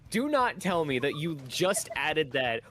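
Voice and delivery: incredulous. Real, not acted. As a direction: plead in playful outrage. Indignant disbelief at opponents.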